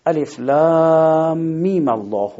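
slow, chanted الف لام میم الله with the mim taking a fatha into الله; recited with fatha on mim. Shown as a demonstration.

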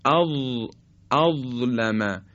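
اظلم slowly, dental zaa; zaa demo. Shown as a demonstration. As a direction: stretch اظلم with a tongue-tip zaa.

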